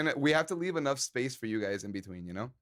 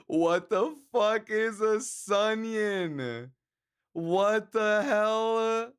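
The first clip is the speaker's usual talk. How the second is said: very slow, higher, stretched words; drawn out; 6 semitones higher, much slower than usual. Pretend. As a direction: drag every word out in disbelief. Repeating the chat name, stretched out.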